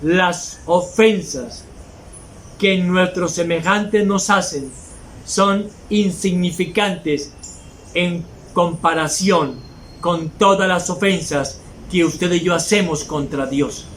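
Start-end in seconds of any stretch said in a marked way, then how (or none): 0.0-11.6 "las ofensas" drawn out slowly, each word weighed; slow it right down so the congregation hears every word; slow, weighty emphasis. Shown as a demonstration. Preacher repeating to his church, slow and deliberate. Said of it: "óigalo bien".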